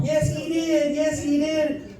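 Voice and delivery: put-on high, slow, gleeful old lady; high grandma voice. Grandma gleefully telling mom, in a high old-lady voice. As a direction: tattle like a delighted grandma.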